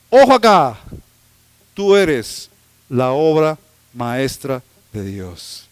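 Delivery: slow and weighty, each phrase set apart; slow emphatic preaching. Acted. Preacher telling the congregation they are God's masterpiece, slow and weighty. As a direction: lay each phrase down slowly.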